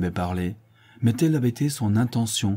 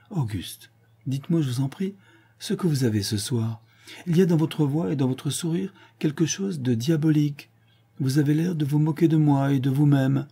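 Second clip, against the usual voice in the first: a hint of a higher, teasing lady's voice; lightly voiced countess; 7 semitones higher, 3 dB louder than usual. A narrator's reading. Countess chiding her lover, lightly higher voice.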